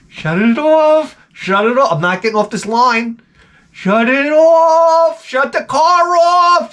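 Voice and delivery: high, slow, drawn-out yell; frantic yelling. Retold or imitated. Husband yelling to wife to shut the car off. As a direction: yell it to her across the lot. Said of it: "I was saying it at that volume".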